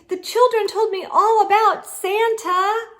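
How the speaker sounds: high, loud delighted cry; excited shout. A narrator's reading. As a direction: burst out with joy at the sight. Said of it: "the snowman shouted excitedly".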